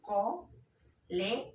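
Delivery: very slow, split into syllables with clear gaps; teacher sounding out syllables. Shown as a demonstration. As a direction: break colegio into co, le, gio, one beat each. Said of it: "Lo dividimos en unidades más pequeñas".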